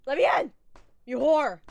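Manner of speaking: loud playful yelling at the door; hammed-up demand to be let in; mock yell. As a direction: bang on the door and shout.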